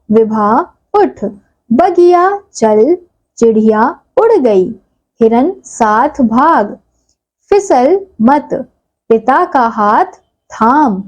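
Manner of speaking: slow, careful reading aloud; reading to children. Acted. Teacher reads the practice sentences aloud to the class, slowly. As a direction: read each short sentence slowly for young learners.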